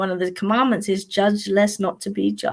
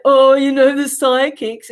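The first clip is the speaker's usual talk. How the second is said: funny caller voice; 3 semitones higher, 5 dB louder than usual. Retold or imitated. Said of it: "he had this real funny voice".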